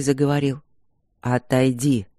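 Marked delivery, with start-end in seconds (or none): 1.2-2.1 deep commanding voice for the dragon; low, firm order; low stern warrior. A narrator's reading. Dragon warrior speaks first time.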